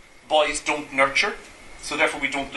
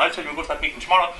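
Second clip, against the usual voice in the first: normal talking voice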